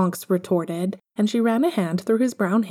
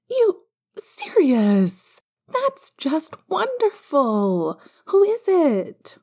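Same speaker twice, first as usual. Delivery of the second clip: excited; 10 semitones higher, 3 dB louder, much slower than usual. Acted. Lily thrilled by news.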